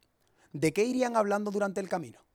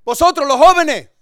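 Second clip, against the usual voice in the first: put-on much higher, much louder call to the young ones; hammed-up pastor yelling at the youth; pastor's shout; 10 semitones higher, 19 dB louder than usual. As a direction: bellow across the church to grab the youths. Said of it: "Entonces Valentín dice".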